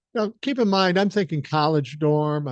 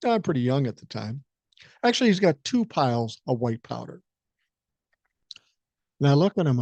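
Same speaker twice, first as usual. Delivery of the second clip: ordinary delivery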